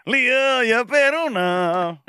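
high, slow sung-out intro call; song intro tag. Retold or imitated. Host mimics a Popeda intro shout, high and slow. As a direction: draw it out high like a band shouting its name. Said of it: "niin siihen alkuun".

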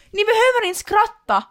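ranting at laugh track. Pretend.

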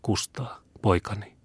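put-on name the child softly as strength fades; fading murmur. A narrator's reading. Dying mother calls her son by name, fading softly. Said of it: "kuiskasi hän sammuvalla äänellä".

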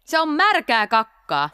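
knowing exclamation. A narrator's reading. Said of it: "Huudahti pöllö tietäväisenä".

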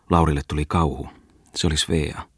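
ordinary delivery

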